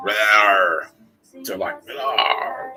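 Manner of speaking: mock monster roar. Imitating the worm attacking.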